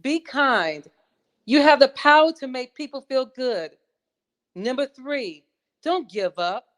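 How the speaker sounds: high-pitched, declaimed motivational list, falling at phrase ends; audition recitation. Acted. Contestant resuming her audition on the seven rules, high declaimed recitation. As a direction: proclaim each rule with spaced, emphatic phrasing.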